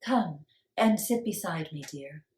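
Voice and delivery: soft wise woman; spoke softly. Acted. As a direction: gently soothe the girl. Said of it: "wise woman speaks".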